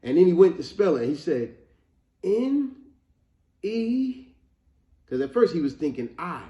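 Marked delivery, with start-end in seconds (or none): 2.2-4.3 high, isolated letters, drawn out hesitantly; imitated reluctant spelling. Retelling the boy at the spelling bee, reluctant. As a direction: spell it like an unsure kid. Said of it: "he was reluctant at that point".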